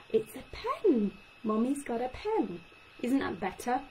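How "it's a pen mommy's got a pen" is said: put-on telling a child. Shown as a demonstration. Speech therapist showing parents how to name things, bright. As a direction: show the child the word gently. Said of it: "tell them what it is".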